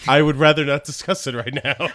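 laughing through an embarrassed dodge. Real, not acted. Guy laughing as friends ask what he spent. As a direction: giggles while refusing to answer. Laughing over the autograph cost.

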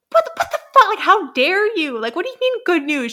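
high, loud outraged shriek; mock outraged squeal at the news; hammed-up indignant outburst; freaking out. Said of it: "She's, like, freaking out".

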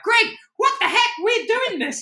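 rally your teammate with glee; fired-up cheer. Retold or imitated. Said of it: "like, genuinely like".

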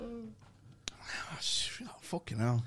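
whispered, slow, aghast; shocked whisper. Real, not acted. Podcast guest, stunned by a joke, whispers. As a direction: breathe it out in mock horror.

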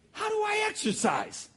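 very high, drawn-out question; pleading question. Retold or imitated. Man asking the Lord for guidance, high pleading question. Retelling his plea to God.

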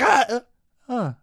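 high, loud cry cutting off into little grunts; wail, then stop short like a baby; imitated baby cry. Dad mimicking newborn as fingers come free, abruptly stopping cry. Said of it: "she's like".

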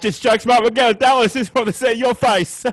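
high and loud, sing-song tease; teasing his mate after fake refusal; gleeful teasing. Joke reveal, teasing mate.

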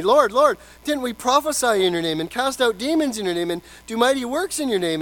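high, loud pleading cry of Lord, Lord; mock desperate plea; pleading crowd. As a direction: beg the judge to remember your works. Pleading on the last day.

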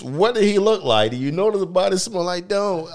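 high, loud hammed-up questioning; mock detective. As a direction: play a frantic detective.

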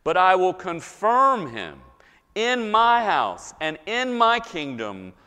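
high, loud, slowing proclamation; emphatic scripture reading. Acted. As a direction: build the promise in weighty phrases. Reads God's promise aloud from scripture.

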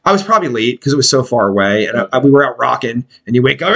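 usual speaking voice

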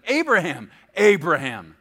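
loud call, second one dropping in pitch. A narrator's reading. Preacher reading angel calling Abraham from heaven, loud calling. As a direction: call the name out firmly, twice. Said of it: "called out to him from heaven".

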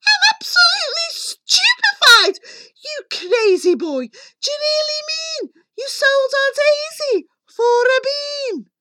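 very high, loud outraged shriek; shrieking outcry. A narrator's reading. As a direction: shriek it at the boy in disbelief. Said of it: "leapt high up in the air and cried".

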